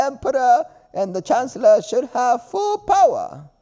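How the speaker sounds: high, goofy Gungan impression; Jar Jar voice. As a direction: play the clumsy alien, high and silly. Voicing Jar Jar in the story.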